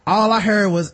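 normal talking voice

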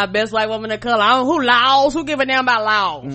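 loud, fast bragging; loud laughing defiance; defiant boast. Real, not acted. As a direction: brag it loudly.

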